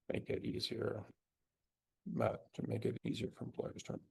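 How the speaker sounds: muttering the words under breath while typing; half-voiced, reading a prompt aloud to oneself; murmured dictation. Real, not acted. Typing a prompt to the chatbot, murmured under breath.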